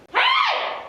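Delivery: very high, loud, screechy bird voice; screechy girl albatross calling out. A narrator's reading. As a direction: shriek it like an annoying seabird. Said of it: "the voice is high-pitched and screechy".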